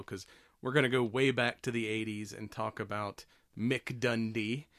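ordinary talk, nothing marked

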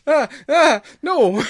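high panicked yelp; panicked shout. Retold or imitated. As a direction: cry out in alarm. Acting out a panicked scene.

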